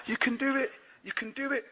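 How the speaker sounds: very faint, high far-away cheer; distant crowd echo. Acted. Crowd stretching back for miles, faint distant cheering. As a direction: let the cheer fade into the distance. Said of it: "It went back for miles".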